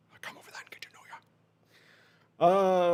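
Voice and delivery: breathy creeper whisper; creepy whisper. Pretend. Creepy flirt line to chatter.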